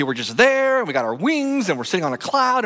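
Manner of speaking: high, sing-song mock of sitting on a cloud; lightly mocking the boring heaven picture; mock dreamy heaven.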